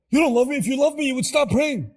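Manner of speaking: loud, high hurt accusation; angry parent. Retold or imitated. Parent rejecting praying child, loud angry accusation. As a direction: lash out like a wounded parent.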